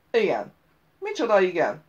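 loud falling "Igen", then demanding; scornful echo. A narrator's reading. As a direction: repeat it loudly with disdain. Voice mocks the bare answer. (lekicsinylő, hangos ismétlés)

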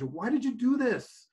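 high, slowed, pleading yell. Acted. Man yelling over the edge at the stranger, high dismayed yell. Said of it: "he yelled over the edge".

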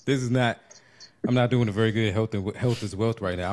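normal talking voice

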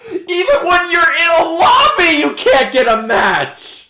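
very high, loud, cracking shout of frustration; frustrated yell. Real, not acted. Gamer raging at broken matchmaking, cracking furious yell. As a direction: wail it at the broken game.

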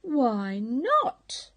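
a lightly voiced puzzled pig; innocent piglet. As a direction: ask it plainly and boldly. Rufus asks captain.

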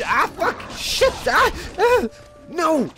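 high, yelping exclamations; panicked cursing. Real, not acted. Gamer being hit by dogs, panicked cursing. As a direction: yelp and swear as each hit lands. Taking hits in the game.

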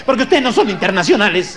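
ordinary talk

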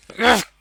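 sudden loud disgusted "öf"; startled loud grunt. Real, not acted. Gamer startled by a creature, loud grunt. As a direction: jolt out a loud "öf".

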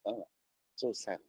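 normal talking voice

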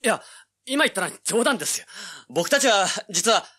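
high, loud flustered pleading; man backpedaling before armed villagers; panicked excuse. Acted. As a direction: scramble to take it back.

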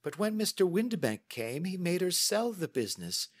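high-pitched, earnest woman's voice; chatty lady client, mildly aggrieved. Acted. Client tells Holmes of her mother's remarriage, mildly aggrieved. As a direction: ramble through the family grievance. Miss Sutherland tells family history.

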